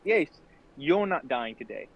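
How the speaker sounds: ordinary talk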